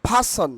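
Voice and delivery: "person" said PHA-son, r dropped; breathy p in "person", as pha-son; corrected pronunciation. Shown as a demonstration. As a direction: say "person" with puffed p and no r. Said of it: "আমার সঙ্গে বলো".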